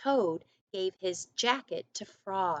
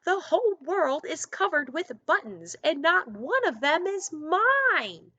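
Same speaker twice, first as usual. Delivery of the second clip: screaming; 9 semitones higher, 6 dB louder than usual. Acted. Toad jumping up and down, screaming in anger.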